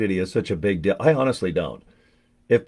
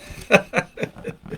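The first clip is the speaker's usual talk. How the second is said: laugh; 14 semitones higher than usual. Real, not acted. Sees what's inside the box.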